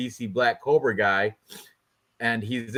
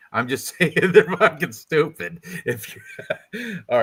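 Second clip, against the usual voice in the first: laughing; 3 semitones higher than usual. Real, not acted. Joking about accents, laughs.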